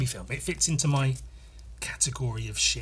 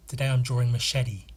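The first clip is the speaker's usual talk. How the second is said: usual speaking voice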